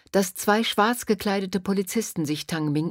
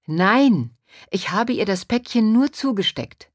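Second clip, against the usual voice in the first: loud, raised-pitch denial; emphatic protest; 5 semitones higher, 6 dB louder than usual. A narrator's reading. Tang Ming denying Han Li is his accomplice, emphatic. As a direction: insist on it forcefully. Boy protests against policeman's accusation.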